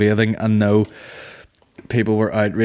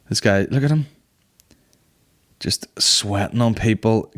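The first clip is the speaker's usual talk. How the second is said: ordinary talk, nothing marked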